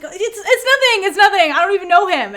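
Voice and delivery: high, loud mock panic; hammed-up frantic backpedalling; flustered denial. Big Nung caught out by the news, mock-panicked denial.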